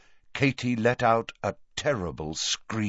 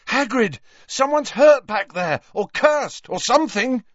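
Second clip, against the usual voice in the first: high, raised, urgent call; urgent shouting over wind; 13 semitones higher, 5 dB louder than usual. A narrator's reading. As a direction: shout the bad news over a raging wind. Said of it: "what Harry was saying over the raging wind".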